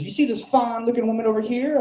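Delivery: put-on high, twangy old barfly voice; aunt needling the comic, high and mocking. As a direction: lean in like a leering old aunt. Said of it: "She says".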